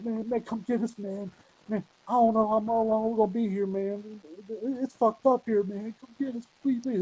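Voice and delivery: high, pleading stranded friend; pleading prank voice. Retold or imitated. Friend begging Drake on the phone, high and pleading. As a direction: beg him to come, pitched high. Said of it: "he's like".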